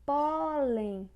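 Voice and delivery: PÓÓlen, high, long PÓ; stress on first syllable. Shown as a demonstration. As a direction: call out Pólen, stretching the first syllable. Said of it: "paroxítona".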